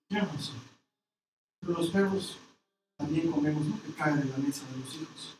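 low, soft humble answer; humble reply. Retold or imitated. The woman meekly answering Jesus in a low voice. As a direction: answer him meekly and quietly.

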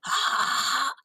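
hoarse, airy "ah"; hoarse sustained vowel; hoarseness. Shown as a demonstration. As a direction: demonstrate a hoarse voice on "ah". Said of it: "One is hoarseness".

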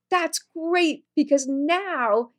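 high-pitched upbeat doctor, stretching the last words; very enthusiastic. Retold or imitated. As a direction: imitate a doctor thrilled with the news. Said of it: "he's very enthusiastic".

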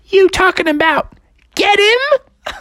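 high cartoon mother, rising question; high-pitched eager mom voice; Froggy's mom. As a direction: ask in a squeaky falsetto, keyed up. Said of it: "And she said".

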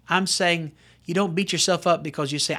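normal talking voice

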